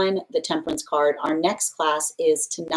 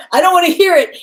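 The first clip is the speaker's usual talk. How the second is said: much higher and louder, hands-over-ears whine; mock earmuff refusal; 12 semitones higher, 11 dB louder, faster than usual. Ego refusing feedback, loud hammed-up refusal. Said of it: "Earmuff energy".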